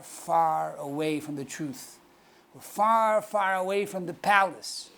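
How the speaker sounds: normal talking voice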